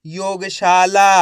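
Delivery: योगशाला joined up slowly with long, loud vowels; drawn-out blended word. Shown as a demonstration. Teacher blending the letters for learners, योगशाला drawn out slowly. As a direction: stretch योगशाला, holding the शा and ला. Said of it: "तो मिलके बन जाएगा".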